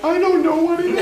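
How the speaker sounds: very high-pitched mock despair; hammed-up high cartoonish protest; comic put-on voice. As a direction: answer in a silly voice far from your own. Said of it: "will you please use your real voice".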